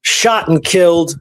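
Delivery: normal talking voice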